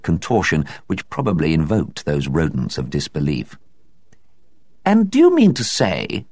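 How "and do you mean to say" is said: raised, lofty society lady; high-pitched doubtful society woman; high lady's voice. A narrator's reading. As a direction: ask in a high incredulous lady voice. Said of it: "asked Miss Resker".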